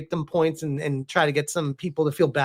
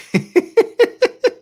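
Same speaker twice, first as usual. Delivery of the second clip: high laugh; 5 dB louder than usual. Real, not acted. Bursts out laughing at mix-up.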